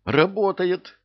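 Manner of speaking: high, loud surprise; high-pitched astonished outburst; amazed report. A narrator's reading. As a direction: exclaim it in happy disbelief. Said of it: "удивлённо сообщил Федька".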